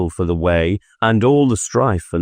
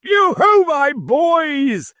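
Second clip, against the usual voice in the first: put-on much higher, slow, rich jolly old master calling; a fat, jovial boss hollering for his apprentices; much slower than usual. A narrator's reading. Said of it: "said Fezziwig".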